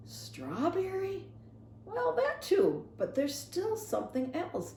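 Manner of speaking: high, slow, musing voice; slow pondering preacher. Acted. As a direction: taste it slowly as you speak.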